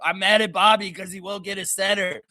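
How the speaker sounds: lightly voiced complaining fan; a hint of a sulking fan griping; whiny fan. Mocking a fan angry at Bobby, mild whiny gripe. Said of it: "some sort of fan that's just like".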